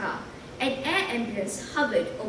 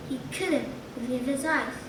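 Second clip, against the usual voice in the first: plain reading voice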